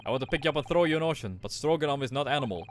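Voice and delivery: put-on proud guard in a Russian accent. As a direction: boast about first class in a Russian accent.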